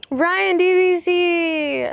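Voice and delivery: loud drawn-out greeting; excited greeting. Real, not acted. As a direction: call the name out slowly. Greeting viewer by name.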